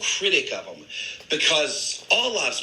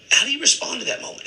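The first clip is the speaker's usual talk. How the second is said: much higher, quicker pointed question; high, fast challenge; 13 semitones higher, faster than usual. Retold or imitated. Coworker pressing him, high and fast.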